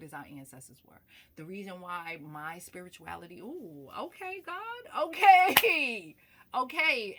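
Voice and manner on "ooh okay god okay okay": ooh, a sudden high gasp of okays; startled overwhelmed. Real, not acted. Speaker overwhelmed by the spirit mid-talk, surprised high okays. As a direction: caught off guard by a feeling, laughing.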